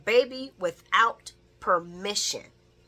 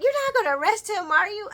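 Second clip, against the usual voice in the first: incredulous; 13 semitones higher, 5 dB louder, faster than usual. Retold or imitated. Viewer talking back to Laura.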